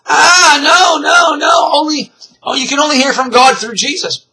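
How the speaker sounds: high, loud mock outcry; hammed-up protest of an offended objector; mock upset. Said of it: "people get upset".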